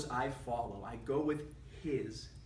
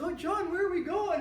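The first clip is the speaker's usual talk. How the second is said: much higher, louder eager question; excited grandma; 13 semitones higher, 7 dB louder than usual. Retold or imitated. Grandma being pulled along by her grandson, excited higher coo. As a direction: coo at him as he leads you off. Grandma led away by toddler.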